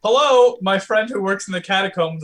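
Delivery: loud, high hello; calling out. Pretend. Caller rings his friend in the catacombs, calling out loudly.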